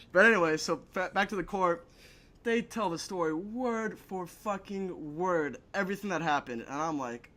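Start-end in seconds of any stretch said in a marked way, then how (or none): 2.4-5.6 "word for fucking word" spaced out, softer; slow, deliberate emphasis. Real, not acted. Back to the court story, slow soft emphatic "word for fucking word". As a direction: measure out each part of "word for fucking word".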